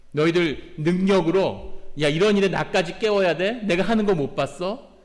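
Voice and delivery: a mock-annoyed Jesus waking up and scolding; irritated Jesus. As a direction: scold the disciples as if fed up. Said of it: "이런 뜻일까요?".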